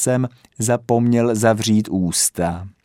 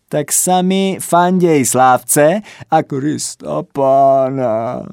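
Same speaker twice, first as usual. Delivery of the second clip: wailing; 6 semitones higher, 4 dB louder, slower than usual. A narrator's reading. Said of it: "zaúpěl".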